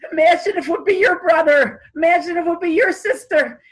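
high, loud, tearful pleading; sobbing plea. Retold or imitated. Rabbi begging the gathering to feel for the hostages, sobbing. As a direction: cry it out to the crowd, voice breaking. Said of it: "He's just crying and crying".

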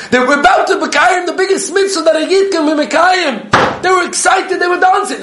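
very high, laughing, loud exclamation; soaring laughing delight; elated. Real, not acted. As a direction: marvel out loud, laughing with joy. Story climax, laughing elation.

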